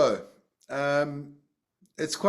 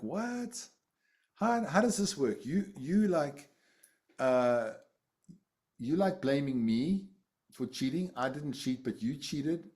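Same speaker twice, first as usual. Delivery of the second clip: incredulous; 2 semitones higher than usual. Retold or imitated. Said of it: "I was like".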